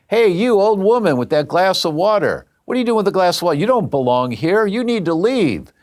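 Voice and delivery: high-pitched teasing call-out; mock heckling. Family teasing aunt over her glass of water, mock outrage. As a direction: imitate relatives jeering across a wedding party.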